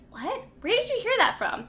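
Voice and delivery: high, startled questioning; imitated surprise. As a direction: act taken aback, pitch jumping up. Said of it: "Elizabeth is like".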